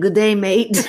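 laughing mock Australian "good day, mate"; hammed-up Australian goodbye with a laugh; Australian accent. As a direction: sign off like a cheery Aussie. Quirky sign-off, mock accent.

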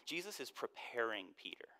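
hushed whispered emphasis; soft, breathy aside. Real, not acted. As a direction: lean in and whisper it.

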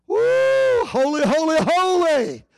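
high whoop, then "holy" called out; ecstatic praise. Real, not acted. Preacher lifting the congregation into worship, ecstatic cry.

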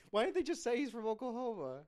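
loud, high-pitched incredulous yelling; exasperated comic shout. Real, not acted. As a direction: yell in outraged disbelief. Comic rant at the filmmakers.

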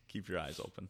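fighting a sneeze while listening; holding back a sneeze. Real, not acted. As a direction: hold in a sneeze, tense. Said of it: "I'm trying not to sneeze".

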